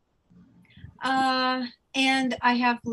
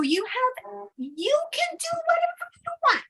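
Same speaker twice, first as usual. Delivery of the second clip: higher, louder, giddy fairy cheer; cheering fairy guides; 7 semitones higher, 7 dB louder, faster than usual. Acted. Fairy guides urging Leah to take action, loud and giddy. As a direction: hype her up like excited fairy cheerleaders.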